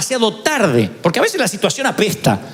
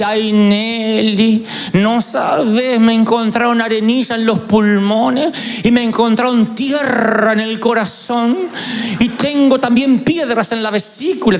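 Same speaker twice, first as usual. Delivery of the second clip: whiny sickly aunt; 2 semitones higher, slower than usual. Retold or imitated. Aunt listing her illnesses to the family, hammed-up whiny lament. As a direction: ham up a hypochondriac aunt moaning. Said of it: "así".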